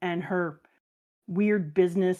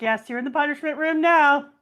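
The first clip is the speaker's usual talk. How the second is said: higher, louder teasing verdict; gleeful mock sternness; 8 semitones higher, 5 dB louder than usual. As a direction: gloat over the grounded kid. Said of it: "I'd be like".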